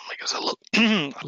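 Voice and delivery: hoarse, failing voice; losing voice. Real, not acted. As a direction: voice giving out, half whispered. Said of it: "I'm losing my voice again".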